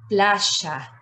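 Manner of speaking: "playa" said "plasha"; the y in "playa" as a strong sh; Argentine sh. Shown as a demonstration. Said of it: "La sh, muy fuerte".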